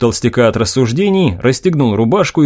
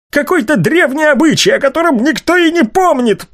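angry rant; 12 semitones higher than usual. A narrator's reading. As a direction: rage at her, pitched high. Enraged father rants at daughter.

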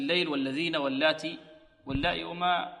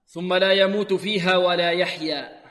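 louder slow recitation of the verse; Quran recitation; 5 dB louder, slower than usual. Shown as a demonstration. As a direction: recite it fully. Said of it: "recitation of verse".

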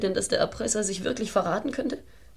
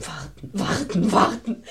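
rising exasperation; slower than usual. Acted. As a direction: start under your breath and build to a cry. Desperate mother repeating the order.

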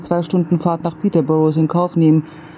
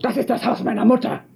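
high indignant retort; angry; 7 semitones higher than usual. Acted. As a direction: shout it back. Shouting back in quarrel.

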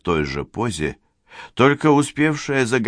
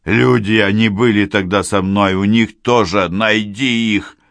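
put-on urgent, louder dying old man; having a heart attack; 2 semitones higher, 5 dB louder than usual. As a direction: gasp out last orders.

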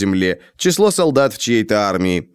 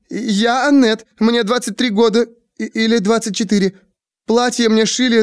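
wailing Annette; 11 semitones higher than usual. Acted. Annette laments inches and feet, wailing high. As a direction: wail it out in panic. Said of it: "запричитала ещё громче".

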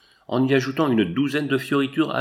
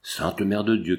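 usual speaking voice

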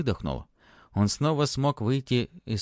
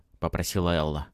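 plain reading voice

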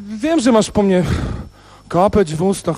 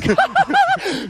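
delighted laugh; 13 semitones higher than usual. Acted. Radość po piosence.

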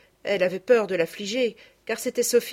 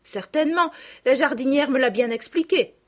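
put-on confident, louder girl's reply; assured voice; 6 semitones higher, 5 dB louder than usual. A narrator's reading. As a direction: answer firmly in a raised child's pitch. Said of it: "répondit Sophie d'une voix assurée". (d'une voix assurée de fillette)

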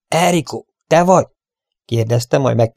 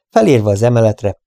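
plain reading voice

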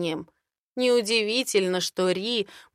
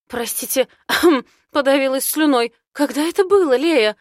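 throat-clearing cough; 6 semitones higher, 8 dB louder than usual. Acted. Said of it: "Ри у окна закашливается".

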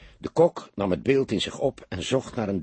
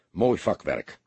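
ordinary delivery, nothing marked

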